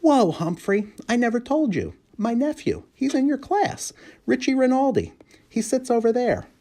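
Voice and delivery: high, bright sudden discovery; light up with the news; excited janitor. A narrator's reading. Uncle telling the hamster his nephew is in class, high and excited.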